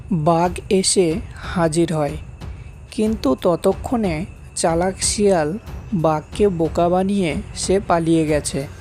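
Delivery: usual speaking voice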